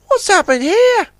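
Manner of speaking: very high, loud eager tug voice; bright busy little tug in a hurry; tug Ten Cents. Acted. Ten Cents talks with Puffer, high and urgent.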